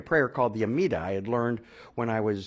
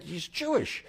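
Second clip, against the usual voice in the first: much higher, thrilled realization; excited; 9 semitones higher, slower than usual. Retold or imitated. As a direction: gasp it like great news. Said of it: "In fact, I was excited".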